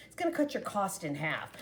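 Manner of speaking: ordinary talk, nothing marked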